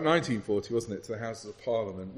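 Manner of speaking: usual speaking voice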